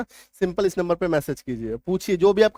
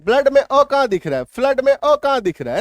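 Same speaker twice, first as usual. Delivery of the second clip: higher-pitched, louder student asking sir; puzzled student; 6 semitones higher, 3 dB louder than usual. Pretend. Student asks teacher where the vowel is, puzzled. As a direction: play a confused student questioning the teacher. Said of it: "आप कहें".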